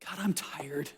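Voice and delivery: whispered confession of weariness; a voiceless, tired admission to God. Pretend. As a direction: breathe it out, worn out.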